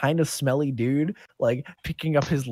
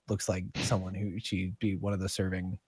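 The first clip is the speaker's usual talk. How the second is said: usual speaking voice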